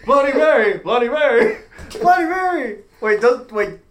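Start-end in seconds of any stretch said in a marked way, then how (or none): 0.1-2.8 frantic shouting. Retold or imitated. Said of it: "he's like".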